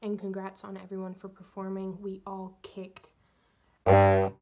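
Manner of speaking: soft whispered morning chat; whispering. Real, not acted. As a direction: whisper it, barely voiced. Quiet morning-after recap.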